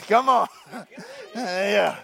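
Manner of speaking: usual speaking voice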